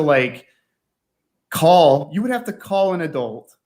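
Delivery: usual speaking voice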